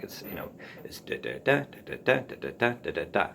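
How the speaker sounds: da da DA beats tapped out in a sing-song; anapestic rhythm. Shown as a demonstration. Teacher chanting the anapest beat to listeners, sing-song da da DA. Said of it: "really pleasing rhythm tends to be anapestic like that".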